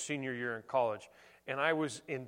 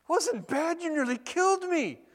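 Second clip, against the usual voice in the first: much higher, astonished "Wasn't bad?" in mock disbelief; incredulous retort; 12 semitones higher, 4 dB louder than usual. Worn-out wrestler answers the old champion in high, incredulous disbelief. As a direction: squeak it out in outraged disbelief.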